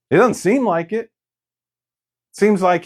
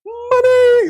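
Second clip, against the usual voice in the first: a big, high, ringing cry of "Money!"; jubilant shout; 5 dB louder than usual. Retold or imitated. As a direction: shout it out like hitting the jackpot. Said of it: "And then God's like".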